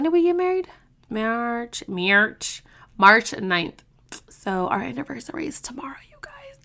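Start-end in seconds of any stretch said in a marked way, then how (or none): 4.3-6.6 soft, fast whispered aside; whispered giddy aside; excited whisper. Real, not acted. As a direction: share a secret with viewers.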